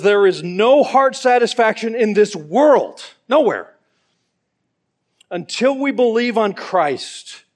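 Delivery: loud, high, driving pulpit delivery; full-force preaching. Acted. Preacher pressing Ryle's words on the congregation, full-force preaching. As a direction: preach the quote hard to the back row.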